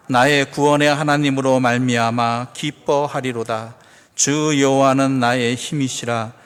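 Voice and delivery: measured, even-pitched loud recitation; congregational scripture reading. Real, not acted. Pastor reading verses together with congregation, measured recitation. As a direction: lead everyone through the verse, drawing out phrase endings.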